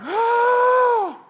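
long, very high mock scream; women's horrified shriek. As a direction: imitate a roomful of women shrieking. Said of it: "All the women were like".

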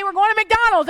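high, loud "McDonald's" announcement; excited. Retold or imitated. Mom announcing McDonald's to excited kids. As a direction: sell "We're going to McDonald's" as a thrill. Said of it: "But if I say".

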